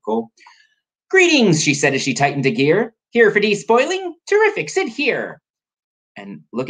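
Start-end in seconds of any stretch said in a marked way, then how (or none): 1.1-1.6 high, loud storybook hail; hammed-up welcome from an eccentric professor; professor's greeting. Biscotti hails her customers. 3.1-5.4 high-pitched eccentric professor voice; storybook professor, pitched way up; Professor Biscotti. Acted. Biscotti welcomes customers.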